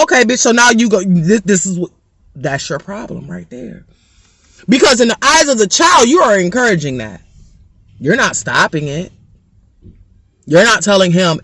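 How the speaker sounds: usual speaking voice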